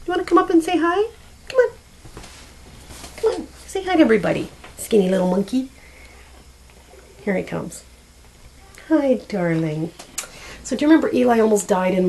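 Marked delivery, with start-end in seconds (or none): 0.1-5.7 higher, sing-song coaxing of a pet; coaxing the cat. Real, not acted. Calling her old cat up to the camera, high coaxing. 8.9-9.9 tender greeting. Real, not acted. Greeting the cat.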